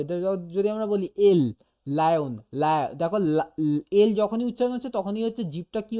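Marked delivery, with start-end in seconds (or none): 1.9-2.9 লায়ন drawn out slowly, the লা held open; slow mouth demo. Shown as a demonstration. Teacher showing a student the L mouth shape, drawn out. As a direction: stretch লায়ন so the tongue on the teeth shows. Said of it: "তাহলেই এরকমভাবে".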